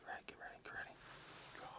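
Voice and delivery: hushed warning to the shooter; urgent whisper. Real, not acted. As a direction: whisper a get-ready cue, barely audible. Warning the shooter as elk approaches.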